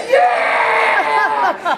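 high, shouted, laughing Yeah; exultant yell. Retold or imitated. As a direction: let it ring across the beach. Celebrating in the water.

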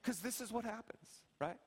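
softly spoken, unruffled retold reproof; calm, hushed I-told-you-so; calm dad lecture. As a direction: quietly make the teaching point to a crying child. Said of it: "I just calmly look over".

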